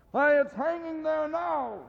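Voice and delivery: put-on high, slow voice of a young lad; a street boy answering; boy's voice. The boy answering Scrooge, in a high lad's voice. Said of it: "replied the boy".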